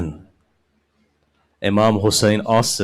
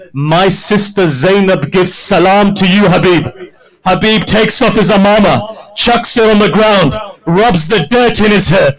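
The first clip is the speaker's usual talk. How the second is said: high, raised preaching voice; full-force preaching; 9 semitones higher, 6 dB louder than usual. Acted. Preacher rousing the mourners, high and raised. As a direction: lift the hall with the slave's devotion.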